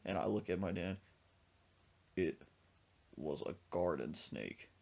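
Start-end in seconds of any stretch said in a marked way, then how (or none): 2.1-4.7 deadpan. Retold or imitated. Deadpan letdown answer.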